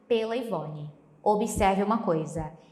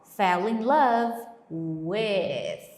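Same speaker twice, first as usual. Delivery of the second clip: very slow, drawn out; slow emphatic; 2 semitones lower, much slower than usual. Shown as a demonstration. Teacher stressing the phrase to remember. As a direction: stretch fell in love with. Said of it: "Tatue isso em seu coração".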